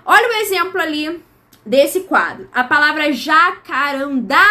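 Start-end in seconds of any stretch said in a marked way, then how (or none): 3.1-4.5 JACARANDÁ with a long, stressed final DÁ; stress on last syllable. Shown as a demonstration. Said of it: "A sílaba mais forte tá na última".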